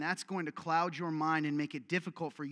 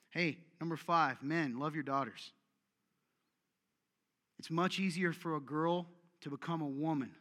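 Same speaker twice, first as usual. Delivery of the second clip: usual speaking voice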